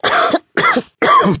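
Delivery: harsh choking coughs. A narrator's reading. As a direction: cough on toxic gas. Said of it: "in between coughs".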